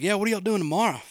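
casual imitation of a friend asking to write; imitating Luke. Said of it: "And he was like".

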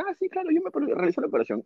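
cheery reply. Retold or imitated. Telling the cousins about his operation, cheery high start. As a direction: pop up into a happy answer.

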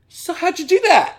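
squeaky mock voice pitched well up; a naive sister's squeaky question; mock high squeak. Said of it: "she's like".

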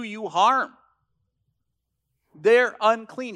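loud, impassioned pulpit delivery; full-voiced sermon climax, driving each line; preaching at full force. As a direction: thunder the warning at the congregation. Preaches Paul's warning loudly.

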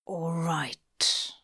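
whispered through tight teeth; gritted out. Acted. Crowley giving in to the angel, gritted whisper. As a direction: force it out through clenched teeth. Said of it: "Crowley had gritted out".

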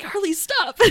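high, amused command to a dog; mock scolding. Bride to her barking dog, mock scolding. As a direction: scold the dog, half laughing.

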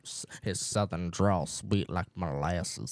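low, slow southern drawl; mock southern drawl, slow and low. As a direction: stretch it out sweet and lazy. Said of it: "how we talk about his".

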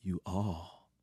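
low quiet announcer read; news announcer. Shown as a demonstration. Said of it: "said it like an announcer".

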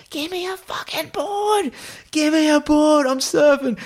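very high, strained mock yell; retold angry yelling. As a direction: act out losing your temper at your mates. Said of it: "start yelling, like, getting angry".

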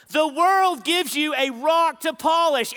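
loud, high, emphatic sermon line; preached loud. Acted. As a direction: hammer the line home to the crowd. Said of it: "repeated softly after".

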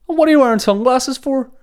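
high, loud challenge; hammed-up, high-pitched disbelief at a mate; mock incredulous mate. Mate challenges him over sunglasses.

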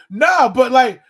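mock protest. People questioning his answer about slavery, in mock protest. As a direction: imitate someone pushing back loudly.

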